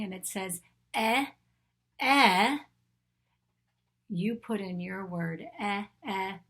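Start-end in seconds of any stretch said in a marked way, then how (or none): 0.9-2.6 eh as in elephant, second one much louder; short E sound. Shown as a demonstration. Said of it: "Let's do the sound of an E". 5.6-6.4 two separate eh sounds, as in elephant; short E sound. Shown as a demonstration. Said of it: "Let's do the sound of an E".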